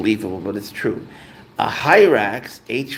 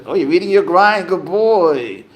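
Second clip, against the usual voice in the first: higher, louder pet-praise voice; praising a pet; 6 semitones higher, 5 dB louder than usual. Real, not acted. Owner sees pet eating and praises him, pet-praise voice. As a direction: break off to praise the animal.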